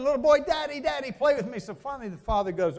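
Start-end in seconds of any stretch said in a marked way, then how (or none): 0.0-1.6 put-on high, pleading little-boy voice; little boy nagging. Pastor voicing the little son begging his busy dad to play, high childish whine. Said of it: "he'd start doing".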